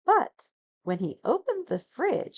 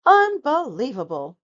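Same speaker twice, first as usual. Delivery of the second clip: rumbling; 5 semitones higher, 7 dB louder than usual. A narrator's reading. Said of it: "rumbled Bear".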